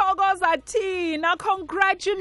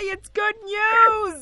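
joyful cheer; 6 semitones higher, much slower than usual. Real, not acted. Host comforting tearful winner.

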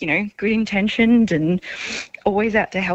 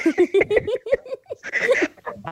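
giddy squeal of laughter; high delighted giggle; joyful high laugh. Real, not acted. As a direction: laugh out the shock of joy. Sister overwhelmed with joy.

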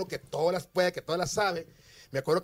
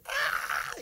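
high mariachi yell imitated; mariachi grito. Imitating the mariachi grito he can't do. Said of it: "el mariachi hace".

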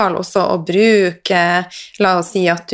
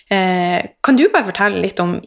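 usual speaking voice

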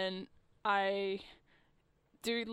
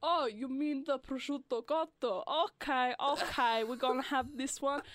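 put-on Italian waiter accent, higher and louder; 4 semitones higher, 5 dB louder than usual. Waiter hamming up Italian for customers. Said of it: "I kinda put it on".

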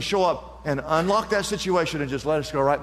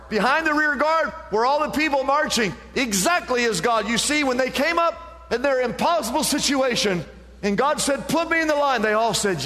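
raised, rhythmic pulpit preaching; full-voiced sermon delivery; 7 semitones higher than usual. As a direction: drive the refrain home to the congregation. Preaching the main point.

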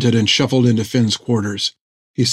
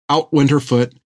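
ordinary delivery

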